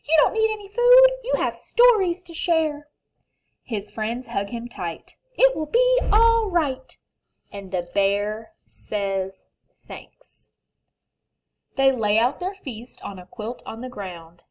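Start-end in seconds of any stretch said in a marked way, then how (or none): 0.0-2.9 very high, loud squeaky comfort; a tiny mouse reassuring Bear. Acted. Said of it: "Mouse squeaks". 5.3-7.0 high, louder soothing reassurance; high comforting critters. Acted. Friends comfort Bear. 9.9-10.5 soft breathy whisper; whispered thanks. Acted. Bear's refrain whispered.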